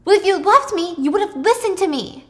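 high, loud reproach; bitter retort. Acted. Thomas answering Pippa's declaration of love, angry reproach. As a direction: turn their plea against them.

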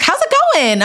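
high, bright friendly greeting; a cheery catch-up question; lightly voiced upbeat friend; friendly check-in. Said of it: "she was like".

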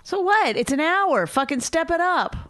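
loud, high exasperated scold. Real, not acted. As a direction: chew him out. Scolding co-host.

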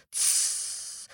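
a soft unvoiced hiss; fire hiss. A narrator's reading. Fire monster hissing as water hits, soft hiss. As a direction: hiss out like a dying flame. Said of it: "He hissed".

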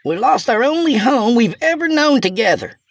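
high, carrying speech to the cabin; shouting. A narrator's reading.

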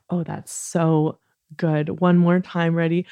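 ordinary delivery

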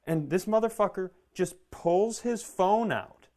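usual speaking voice

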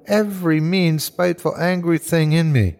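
high-pitched, swelling resentment; mounting bitter anger. Acted. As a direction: let the spite build as it takes you over. Dying man swelling with hate.

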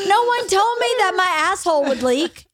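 ordinary delivery

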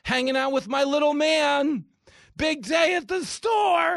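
high, loud, slow sing-song parent voice; mock Instagram parent. Host mocking a parent posting on Instagram, high sing-song. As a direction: gush like a proud influencer mom. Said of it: "you post it on Instagram".

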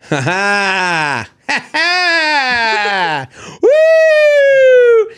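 mocking laugh that climbs into a shrieking whoop; jeering catcall. Random guy jeering at a man's date, whooping shout. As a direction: jeer at the couple like a rowdy stranger. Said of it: "random guy will go".

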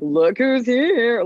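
mock showy greeting, high, loud and very slow; loud attention-seeking entrance. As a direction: stretch it out like you want the whole room to turn and look. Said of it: "Like, I don't walk into a room like".

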